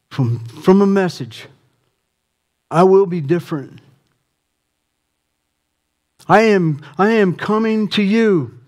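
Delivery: climbing preacher's cry; preaching at full force. Acted. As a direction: build the plea up like a revival preacher.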